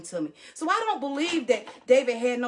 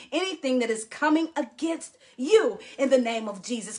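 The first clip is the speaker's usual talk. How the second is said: ordinary talk, nothing marked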